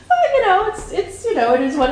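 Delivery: high laughing voice, breaking into giggles; laughing friend. Retold or imitated. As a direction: laugh it off up high, a little rushed. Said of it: "She's like".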